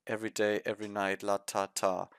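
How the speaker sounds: flat, soft, lifeless mumble; monotonous. Retold or imitated. Reviewer mocking the idols' monotonous delivery. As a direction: drone it with no emotion. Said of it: "the way they're saying was".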